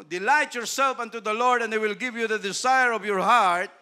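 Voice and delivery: verse proclaimed from the pulpit, loud; scripture quote. Acted. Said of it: "quotes scripture".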